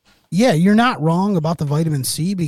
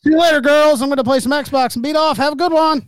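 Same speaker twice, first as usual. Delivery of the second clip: mock cheery sign-off; 11 semitones higher, 4 dB louder than usual.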